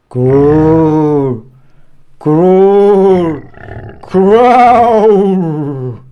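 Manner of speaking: spooky growl. A narrator's reading. Narrator voicing the scary forest growl, menacing. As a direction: rumble it like a hidden beast. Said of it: "the scariest sound of all".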